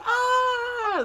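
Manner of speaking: high hammed-up scream; short fake shriek; mock scream. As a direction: scream as the gremlins strike. Man attacked, retold.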